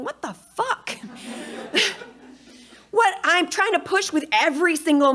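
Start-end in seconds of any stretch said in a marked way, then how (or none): 0.0-1.0 much higher, baffled what the fuck; mock stunned disbelief; thinking it in total confusion; incredulous inner thought. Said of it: "And I remember just thinking". 1.0-2.0 short unvoiced giggle; a quick disbelieving laugh; breathy high laugh. Real, not acted. Laugh after retold disbelief.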